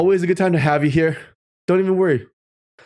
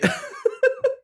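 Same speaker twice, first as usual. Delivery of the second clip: squeaky giggle; high laugh; 4 dB quieter than usual. Real, not acted. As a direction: laugh at your own joke. Laughs at own joke.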